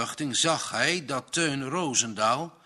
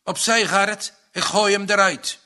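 furious shout; 7 semitones higher, 8 dB louder than usual. A narrator's reading. Enraged farmer pushing past.

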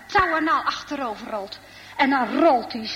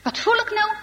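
much higher, puzzled startled cry; startled cyclist; 9 semitones higher, faster than usual. Acted. Cyclist feels the rope tug and cries out startled. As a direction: sound caught off guard by a tug. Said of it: "Hé," zegt die fietser".

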